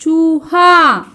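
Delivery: चूहा very slow, both vowels stretched; pronunciation demo. Shown as a demonstration. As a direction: chant out चूहा for pupils to repeat. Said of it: "कैसे उच्चारण करना है?".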